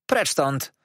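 put-on sharp shout to chase a boy off; shouting aunt. A narrator's reading. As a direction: bark it at the ragged boy. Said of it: "zawołała". (gniewny okrzyk ciotki)

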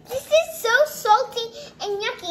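real disgusted complaint, loud start then dragged-out ending; disgusted verdict. Real, not acted. Child tells family how salty it tastes, disgusted. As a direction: draw out your disgust.

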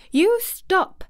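high, loud angry order; sharp, high-pitched command; near shout. A narrator's reading. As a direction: cry it out furiously, just short of a scream. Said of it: "she almost shouted".

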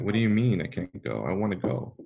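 ordinary delivery

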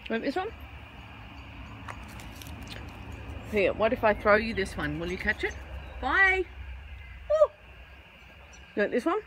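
high, sing-song voice coaxing a bird; pet talk. Acted. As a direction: coax the little bird over, sweet and high. Offering food to birds.

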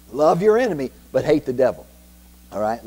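usual speaking voice

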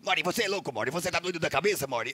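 lightly voiced cartoon rant; cartoon character voice. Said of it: "ele é muito bom pra imitar".

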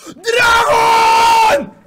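very high, long, full-out scream; ecstatic scream. Real, not acted. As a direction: scream it, stretching the last syllable. Screams at Dragon's reveal.

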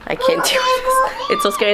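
very high, squeaky mock sob; hammed-up tearful panic; retold crying. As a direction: squeal it as if overwhelmed by tears. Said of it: "me crying".

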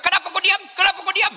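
high, whiny, laughing nag; nagging wife. Retold or imitated. Preacher mimics a wife nagging her silent husband, high and whiny. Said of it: "dia bilang".

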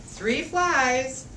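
usual speaking voice

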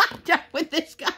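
laughing it out in disbelief; giggling helplessly at the table guy. Real, not acted. Laughing at the water order.

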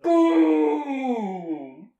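huge, drawn-out falling boom; explosion shout. Acted. Narrator acting out the blast, sudden shouted sound effect. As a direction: blast it out loud and high, then let it fall.

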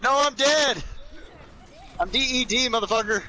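yelling. Real, not acted. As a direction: shout that you died. Dead in game, yelling.

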